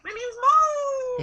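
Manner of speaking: very high, slow squeaky cartoon voice. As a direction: squeak it out like a tiny cartoon girl. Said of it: "a squeaky voice".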